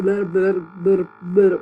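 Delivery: loud, loose-tongued blah-blah; demonstrating sloppy, uncontrolled speech; uncontrolled tongue. Shown as a demonstration. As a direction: let the tongue flop through each blah. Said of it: "getting your tongue controlled, so it's not".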